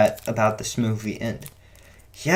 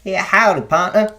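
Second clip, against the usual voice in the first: louder, higher mock Southern cowboy; Texas cowboy accent; 7 semitones higher, 6 dB louder than usual. Reviewer imitating the actors' accents, Texas cowboy accent. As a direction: put on a hammed-up Texan twang. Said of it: "cowboy kinda".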